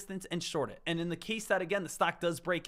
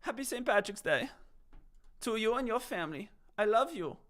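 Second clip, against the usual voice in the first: higher-pitched put-on Irish accent; attempted Irish accent; 4 semitones higher than usual. Shown as a demonstration. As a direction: have another go at sounding Irish, pitched up. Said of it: "I thought that was, again, a better".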